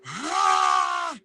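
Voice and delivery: high shrill mock yell; mock shriek. Reacting to Mike's threat, a high mock shriek. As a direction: let out a mocking cry of shock.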